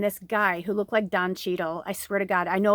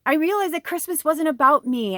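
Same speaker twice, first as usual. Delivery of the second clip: real tearful emotion; 7 semitones higher, 5 dB louder than usual. Real, not acted. Vlogger choking up while retelling her lonely Christmas, tearful. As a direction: let the tears come up as you say it. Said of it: "I'm starting to cry and get emotional".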